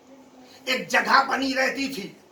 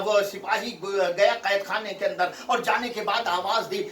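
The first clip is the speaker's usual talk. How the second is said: normal talking voice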